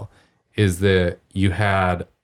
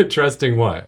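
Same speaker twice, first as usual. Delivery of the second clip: laughing; 6 semitones higher than usual. Real, not acted. Host echoes the guest's question, laughing. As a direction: repeat it with a real laugh, a touch higher.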